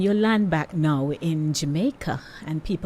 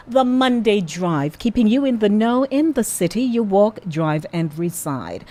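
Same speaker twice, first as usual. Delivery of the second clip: radio slogan; 3 semitones higher, 3 dB louder than usual. Shown as a demonstration. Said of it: "station slogan read".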